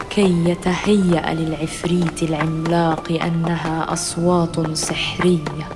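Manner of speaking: ordinary delivery, nothing marked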